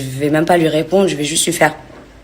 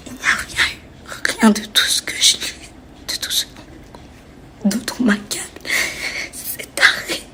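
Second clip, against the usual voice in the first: whispering; 4 semitones higher, 5 dB quieter than usual. Real, not acted. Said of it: "je vais me calmer".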